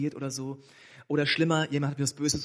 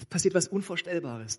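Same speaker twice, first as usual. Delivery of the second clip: ordinary delivery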